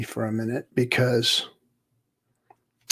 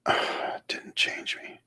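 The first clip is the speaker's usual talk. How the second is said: frustrated whisper to self; 9 dB quieter than usual. Real, not acted. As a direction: whisper it under your breath.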